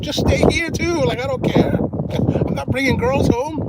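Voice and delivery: high, loud, easygoing insistence; emphatic insisting. Retold or imitated. To his cousin, insisting they share a room, high and emphatic.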